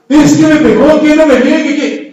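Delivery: put-on high, loud whiny teen insisting; high and loud, a frightened young man on a motorbike; panicky young biker. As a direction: play the frightened kid who keeps repeating himself. Scared young biker voiced in story.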